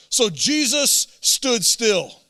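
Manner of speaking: ordinary delivery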